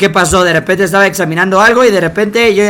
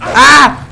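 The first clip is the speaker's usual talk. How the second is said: high loud startled scream; real shocked yell; yell of alarm; 9 semitones higher, 7 dB louder than usual. Real, not acted. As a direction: cry out when hit. Hit during shootout.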